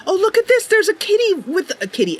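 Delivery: high, delighted cooing. Real, not acted. Streamer spotting a cat pair in game, delighted. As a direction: gush over the cute cats.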